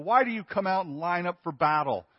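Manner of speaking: read as a shout. Retold or imitated. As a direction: call the line out across the ranks. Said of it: "he shouted to the ranks of Israel".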